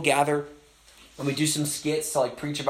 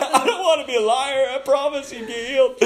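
high, whimpering comic begging through laughter; mock desperate plea; 10 semitones higher than usual. Preacher praying not to be a liar, mock desperate. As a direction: beg in hammed-up panic.

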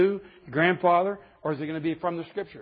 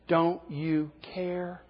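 slow, drawn-out desperate plea; desperate disciples; 3 dB quieter, much slower than usual. Retold or imitated. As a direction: stretch it out like terrified disciples. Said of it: "what do they say".

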